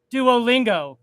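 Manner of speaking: ordinary delivery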